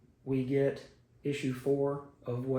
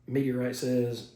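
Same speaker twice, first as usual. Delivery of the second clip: ordinary talk, nothing marked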